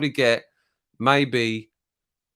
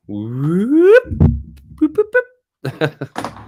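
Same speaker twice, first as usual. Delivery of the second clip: playful boops; 13 semitones higher, 8 dB louder, much slower than usual. Acted. Playing with mouse.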